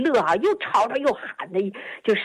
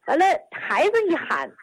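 ordinary talk, nothing marked

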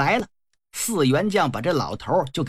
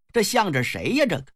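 ordinary delivery

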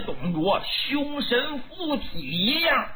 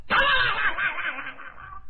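high vocal rattle of a halberd quivering in the wall; rattling halberd noise; 10 semitones higher than usual. Retold or imitated. Storyteller imitates the halberd stuck quivering in the wall, high rattle. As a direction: rattle it off like steel shaking.

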